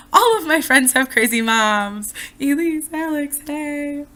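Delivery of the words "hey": normal talking voice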